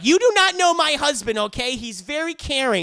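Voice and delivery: high, loud indignant retort; mock indignant. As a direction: snap back in defence of your husband. [name] rebuffing Harley.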